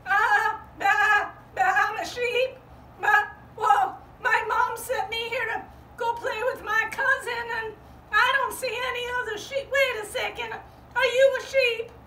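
high, excited lamb voice; a small eager lamb looking for family; little sheep. Acted. Little sheep searching for her cousin, high and eager.

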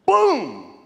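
loud high "Boom!"; punch sound. Retold or imitated. Preacher mimicking decking her, loud. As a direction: hit it like a punch.

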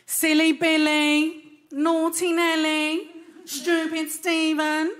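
slow, prim mock scolding; mild western parent. Comic mimicking Western parents cussing kids, slow prim scolding. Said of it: "Western parents... they're like".

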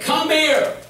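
a wife's sing-song come here; calling him back. Retold or imitated.

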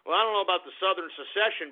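ordinary talk, nothing marked

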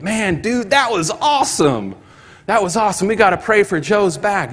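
high, eager burst of amazement; brother-in-law freaking out with excitement; excited friend. Retold or imitated. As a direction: gush like a buddy who just saw a miracle.

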